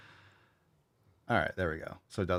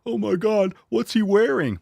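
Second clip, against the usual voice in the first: high, loud gasp of fake shock; hammed-up horrified gawker; mock shocked onlooker; 11 semitones higher, 5 dB louder than usual. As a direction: play a scandalised passer-by. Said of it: "They're like".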